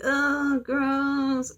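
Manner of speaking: high, stretched-out "ew" reaction; mock disgust. Girl rejects ghost boy hitting on her, mock disgust. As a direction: recoil in exaggerated disgust. She rejects his advances.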